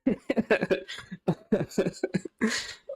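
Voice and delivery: real high-pitched laugh at the picture; helpless giggle. Real, not acted. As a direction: burst out laughing. Laughing at the generated image.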